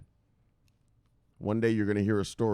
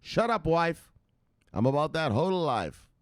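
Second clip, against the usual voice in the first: mock brush-off; 7 semitones higher than usual. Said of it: "So you have to tell her".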